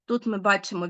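ordinary talk, nothing marked

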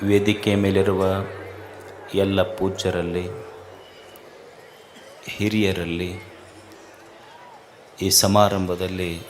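low, soft, measured salutation with long pauses; quiet, low-pitched formal blessing; solemn invocation. Real, not acted. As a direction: open reverently, hushed and low, phrase by phrase. Opens discourse with invocation and salutation.

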